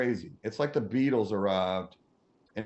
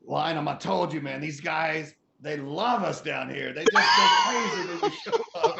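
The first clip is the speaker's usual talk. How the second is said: higher, proud rock star, laughing out at the end; boastful Steven; 4 semitones higher, 3 dB louder than usual. Retold or imitated. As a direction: brag to your friend, climbing higher.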